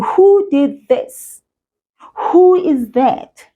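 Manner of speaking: mildly acted "Who did this?"; mock question. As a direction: lightly act out asking who. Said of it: "when I'm saying".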